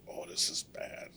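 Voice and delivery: hushed unvoiced dread; whisper. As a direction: breathe it out in pain. Retelling pain in bed.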